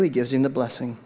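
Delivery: ordinary delivery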